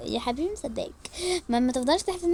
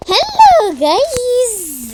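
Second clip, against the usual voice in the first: calling out; 9 semitones higher, 11 dB louder, much slower than usual. Real, not acted. Calling out on arrival.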